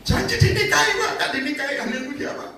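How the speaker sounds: high, whiny mock voice; hammed-up high complaining voice; mock jilted woman. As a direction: imitate a girl complaining about empty promises. Mocking a jilted churchgoer.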